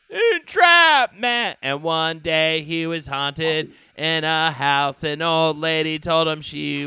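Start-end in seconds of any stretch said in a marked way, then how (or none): 0.1-1.6 high, slow, drawn-out wail of the word; dramatic high wail. Acted. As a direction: stretch Entrapment out high and loud.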